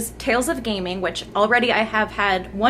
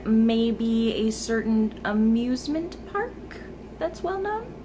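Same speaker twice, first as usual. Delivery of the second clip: coyly trailing upward; coy hint; 4 dB quieter, slower than usual. Real, not acted. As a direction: tease the secret, voice climbing and getting softer. Coyly hinting at a secret surprise.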